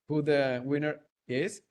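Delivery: ordinary talk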